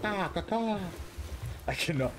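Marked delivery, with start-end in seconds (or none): 0.0-0.8 high cawing crow call; chirping bird person. Pretend. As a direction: caw like a crow, pitched way up. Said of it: "But I like being a crow".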